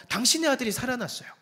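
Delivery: high, fast, loud cry of good news; joyful shout. Acted. Elijah running down to tell the widow her son lives, joyful shout. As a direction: burst out with joy. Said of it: "기쁘게 외쳤겠죠".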